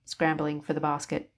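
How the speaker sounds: plain reading voice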